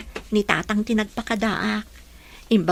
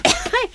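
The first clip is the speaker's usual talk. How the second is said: crying. Acted. Melody crying over her cooking.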